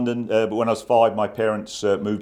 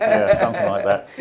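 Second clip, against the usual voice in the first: laughing; 11 semitones higher, 3 dB louder than usual. Real, not acted. Laughs at accent guess.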